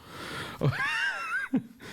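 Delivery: helpless howl of laughter; shrieking laugh. Real, not acted. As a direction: burst into screeching laughter.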